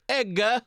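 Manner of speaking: high, loud two-beat holler; punchy imitated street call; imitating brother's catcall. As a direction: toss it out as a mock catcall. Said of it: "he used to say".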